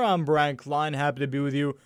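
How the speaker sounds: ordinary talk, nothing marked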